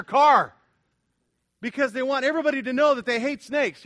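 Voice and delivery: loud, mocking pulpit rant; fiery sermon mockery; preaching at full force. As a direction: ridicule the snake-haters with big sarcastic energy. Mocking religious people's efforts.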